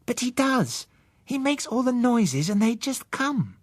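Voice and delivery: put-on high, slow child voice, certain; a girl sure of herself; insistent girl. Susan insisting to Bill, high slow child voice. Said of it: "Susan insists".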